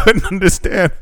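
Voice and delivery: usual speaking voice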